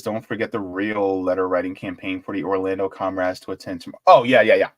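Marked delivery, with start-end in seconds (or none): none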